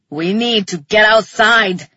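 loud roar in a put-on character voice; roared command. A narrator's reading. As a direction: roar it as an urgent order. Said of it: "he roared".

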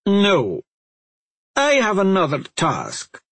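lightly voiced stern older woman; a hint of a commanding elder; stern witch. A narrator's reading. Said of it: "Merrin said".

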